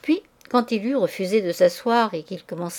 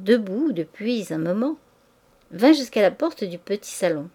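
plain reading voice

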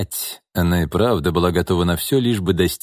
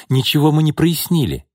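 upset woman; 7 semitones higher than usual. A narrator's reading. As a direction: press him for an answer, hurt. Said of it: "воскликнула Бакшеева".

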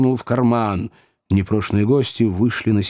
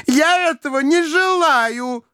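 very high, slow petulant cry; spinster's wail; slower than usual. A narrator's reading. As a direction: wail the refusal, stretching each word. Spinster protests before another fit.

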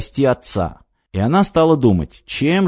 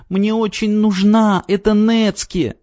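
высокий, медленный детский голосок; тоненько выкрикнуть, как маленькая девочка; тонкий детский выкрик; 11 semitones higher, slower than usual. A narrator's reading. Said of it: "тонко выкрикнула девочка". (девочка тонко умоляет отдать нецке)

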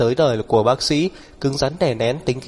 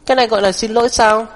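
high, quick indignant retort; matching his volume; 10 semitones higher, 4 dB louder, faster than usual. A narrator's reading. Heroine refusing to back down against him, high indignant retort. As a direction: throw it back at him in a raised pitch. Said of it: "so giọng với anh".

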